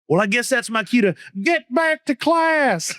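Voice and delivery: cheeky exit line. Retold or imitated. Student caught by principal, high mock innocence. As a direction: sing it out innocently.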